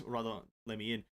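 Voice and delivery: ordinary talk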